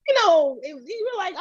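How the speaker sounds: ordinary delivery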